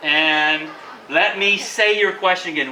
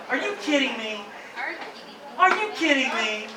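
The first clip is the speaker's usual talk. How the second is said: higher pitched, softer disbelieving question; can't believe the news; stunned disbelief; 5 semitones higher, 5 dB quieter than usual. Shown as a demonstration. Teacher showing students how to react to a lottery win, in disbelief.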